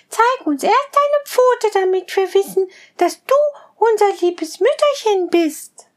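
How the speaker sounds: put-on high, bleating kid-goat voices; little goat kids. Little goats promising their mother to be careful, high and bleating. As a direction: chirp it high like tiny goats.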